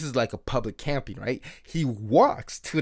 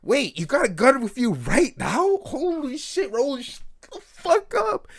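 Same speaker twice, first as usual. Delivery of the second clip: high, rising mock panic; hammed-up horrified disbelief; retold alarmed thought; 10 semitones higher than usual. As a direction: act out silent panic, pitch climbing and slowing at the end. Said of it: "I'm over here thinking".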